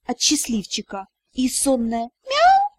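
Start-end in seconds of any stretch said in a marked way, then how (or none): 2.2-2.7 kitten meow. Acted.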